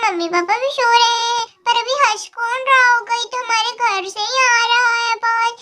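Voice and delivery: ordinary delivery, nothing marked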